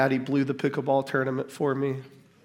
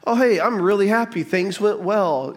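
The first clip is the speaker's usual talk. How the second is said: mock casual; 6 semitones higher, 4 dB louder than usual. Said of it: "This is not just like a".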